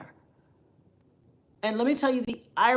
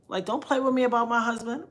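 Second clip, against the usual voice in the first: normal talking voice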